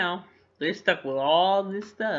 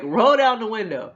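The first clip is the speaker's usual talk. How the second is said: high, loud mock command; shouted hold-up order; 9 semitones higher, 12 dB louder than usual. [name] orders a couple in their car, shouted hold-up order. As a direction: shout it like a comic stick-up. Fake-gun hold-up, acted out.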